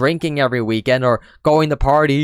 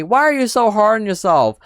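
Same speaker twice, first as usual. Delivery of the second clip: lightly voiced nag, pitched higher; a hint of a preachy relative needling her; mock nagging question; 8 semitones higher than usual. Dismissive relative asking why she's so hard on herself, mild mock nag. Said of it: "always telling me".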